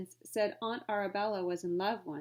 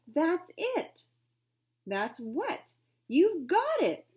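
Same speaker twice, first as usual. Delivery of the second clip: put-on excited boy; 9 semitones higher, 6 dB louder, much slower than usual. Jack's sudden idea.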